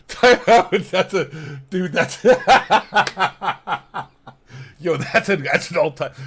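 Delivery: talking through real laughter; laughing. Real, not acted. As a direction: burst out laughing, words high. Cracking up at chat comment.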